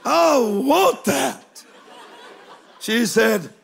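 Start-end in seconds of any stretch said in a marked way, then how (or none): 0.0-1.6 laughing, wistful wish for comfort; milk the longing with a laugh; laughing plea. Acted. Preacher laughing as he pines for first class.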